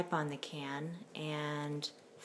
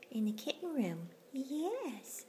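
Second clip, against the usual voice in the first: whispered question then high coo; whispered cutesy pet talk; 7 semitones higher, 3 dB quieter, slower than usual. Real, not acted. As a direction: ask the kitten softly. Whispering to kittens.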